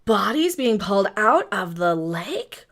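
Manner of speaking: slow, low dramatic opener; show intro take. Pretend. Host trying a true crime opener, slow and dramatic. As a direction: draw it out as an ominous question. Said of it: "I don't like that one either".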